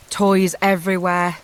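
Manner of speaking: ordinary delivery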